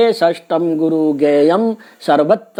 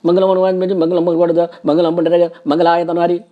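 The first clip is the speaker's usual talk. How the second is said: rushed, hurried recitation; rushed chant; too fast; faster than usual. Shown as a demonstration. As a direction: gabble the verse as the mistake. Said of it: "शीघ्रता भी नहीं होना चाहिए".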